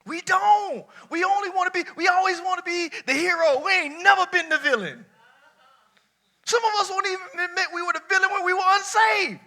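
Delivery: high, loud, building preaching cry. Real, not acted. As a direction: climb into a high sermon cry. Preacher rising to high pitch.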